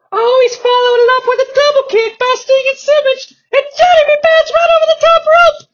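very high, loud play-by-play; excited high announcer. Acted. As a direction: call it at fever pitch. Announcer calls the action.

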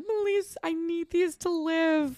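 high, slow, hammed-up begging; mock desperate plea. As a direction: beg like your life depends on it, tongue in cheek.

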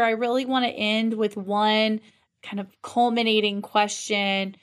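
ordinary talk

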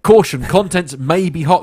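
loud, announcing the warning; reading a warning label. Retold or imitated. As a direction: read it like a sign out loud. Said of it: "they've had to put a thing on it that says".

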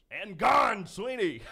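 high, strained imitation of the radio announcer; nervous Denny Matthews impression. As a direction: squeeze out the announcer's call with nerves. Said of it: "All I could muster was".

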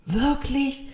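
high, eager question; lifted pitch, light astonished cry; surprised exclamation. A narrator's reading. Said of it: "rief die kleine Seele".

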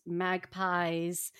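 ordinary talk

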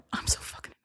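quick whispered aside to oneself; whispered self-reproach. Retold or imitated. Replaying what she tells herself afterwards, whispered. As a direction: whisper it as a private, cringing thought.

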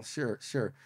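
very soft, dismissive mumble; eye-rolling agreement. Retold or imitated. Nephews humouring aunt, soft eye-rolling agreement. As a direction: humour her under your breath. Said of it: "rolling our eyes".

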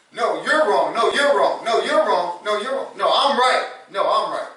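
hammed-up shouting match; spouses arguing. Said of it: "two spouses having an argument".

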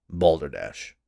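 low, gruff dismissal; dismissive. A narrator's reading. Skeptical husband dismisses séance.

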